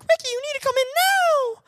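put-on very high girly voice calling him. Friend summoning Ricky indoors, very high girly voice. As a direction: call him in like a demanding girl. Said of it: "they were like".

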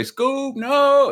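a loud, very high cry of Scoob, no!; high shout. Pretend. Pleading with Scoob to stop, a high panicked shout. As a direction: yelp it out in panic. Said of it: "I say".

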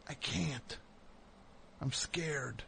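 hushed, frightened whisper; scared whisper. Retold or imitated. Whispering to his friend, too scared to approach Dice. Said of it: "I was so scared".

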